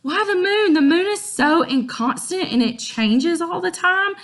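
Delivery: put-on high, loud incredulous girl; sassy Juliet. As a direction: scoff up high in disbelief. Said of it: "Juliet's like".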